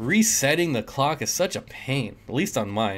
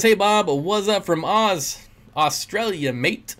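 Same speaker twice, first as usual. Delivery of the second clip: put-on drawn-out amateur Australian accent; attempted Australian accent; 6 semitones higher, slower than usual. Streamer reading out a viewer's greeting from Oz, attempted Australian accent. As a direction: have a half-serious go at an Australian accent. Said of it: "Sometimes I try to do the accent".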